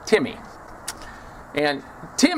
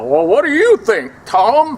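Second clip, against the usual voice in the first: mocking question; 8 semitones higher, 6 dB louder than usual. Professor needles the inmate-named guard.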